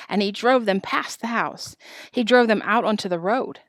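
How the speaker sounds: plain reading voice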